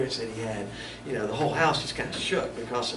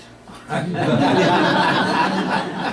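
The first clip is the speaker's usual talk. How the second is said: a real burst of loud laughter; hearty laugh; 3 semitones higher, 7 dB louder than usual. Real, not acted. Preacher laughing at his "I'm toast" line, hearty. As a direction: laugh out loud at your own joke.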